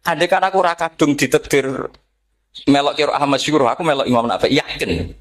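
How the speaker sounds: normal talking voice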